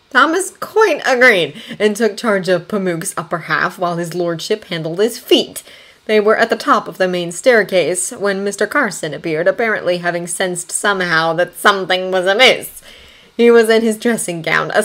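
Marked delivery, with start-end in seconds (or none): none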